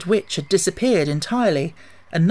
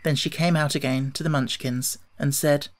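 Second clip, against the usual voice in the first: ordinary delivery, nothing marked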